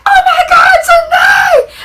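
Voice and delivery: high, loud, frantic screaming imitation; mock scream. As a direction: shriek it like a panicked girl. Sister screaming, retold car accident.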